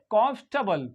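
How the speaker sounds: कंफर्टेबल said "KOMF-tuh-bul"; कंफर्टेबल in three syllables, R dropped; correct pronunciation. Shown as a demonstration. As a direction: stress the first syllable of कंफर्टेबल. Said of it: "क्या पढ़ेंगे?".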